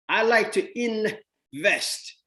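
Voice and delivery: slow, high, proud invest line; slow pleased I like to invest; slow proud declaration. Shown as a demonstration. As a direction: savour each word slowly. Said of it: "proud declaration".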